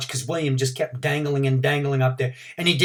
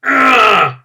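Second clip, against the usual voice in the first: straining grunt, much higher and louder; 9 semitones higher, 12 dB louder than usual. A narrator's reading. Frankie pulling himself up again, loud grunt. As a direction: force it out mid pull-up. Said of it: "Strong Frankie did another grunt".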